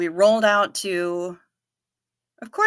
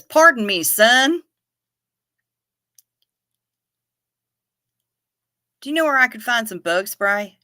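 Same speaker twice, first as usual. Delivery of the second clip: put-on Southern accent; 4 semitones higher, 5 dB louder than usual. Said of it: "he has sympathetic accent".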